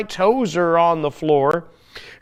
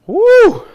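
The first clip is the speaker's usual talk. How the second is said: excited woo; 9 dB louder than usual. Real, not acted. Cheer after jumping exercise.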